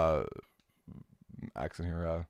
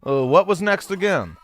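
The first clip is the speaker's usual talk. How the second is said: a hammed-up New York accent for the scolding mole; a loose New York accent, Resetti scolding the player for resetting; bad New York accent. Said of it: "Rossetti's definitely got a New York accent".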